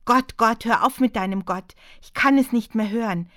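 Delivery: laut und verzweifelt aufschreiend; wütender Ausbruch. Acted. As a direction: schreit es heraus, sie kann es nicht mehr hören. Anna covers ears, outburst. (Anna schreit den Mönch an, wütend und laut)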